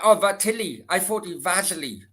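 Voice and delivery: usual speaking voice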